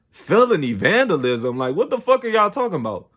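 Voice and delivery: incredulous. Retold or imitated. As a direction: repeat the charge in disbelief. Shocked at the felony charge.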